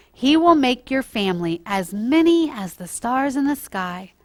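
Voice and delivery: put-on storytime voice for children, lively and clear; gentle storyteller reading to kids; story narration. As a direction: read it like a bedtime Bible story.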